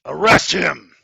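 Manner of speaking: loud, sharp command; captain ordering his guards, shouting; shouted order. Acted. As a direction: bark the arrest order.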